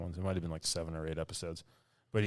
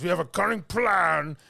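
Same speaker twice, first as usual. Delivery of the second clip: high, loud blustering officer voice; mock general with a giant mustache; pompous general; 11 semitones higher, 10 dB louder than usual. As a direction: announce it like a proud idiot commander. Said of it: "He goes".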